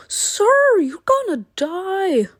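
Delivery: high mock threat. Real, not acted. Threatening the demon.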